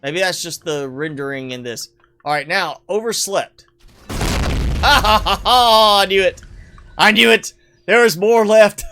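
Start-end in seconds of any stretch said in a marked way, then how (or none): 4.1-6.4 loud laugh running into words; real laughing. Real, not acted. Gamer caught by hidden explosive, laughing loudly. 7.0-8.8 loud, real excited exclamation; excited vindication. Real, not acted. Gamer caught by leftover explosive, loud and amused. Exclaims after surprise blast.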